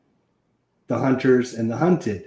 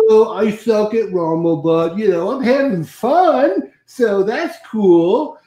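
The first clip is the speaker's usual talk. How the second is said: mock Californian dude, higher and stretched out; 7 semitones higher, slower than usual. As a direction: drawl it like a carefree surfer buddy. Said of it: "When did I become Californian?".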